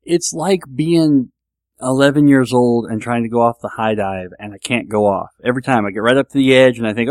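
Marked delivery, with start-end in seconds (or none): none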